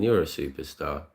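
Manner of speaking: usual speaking voice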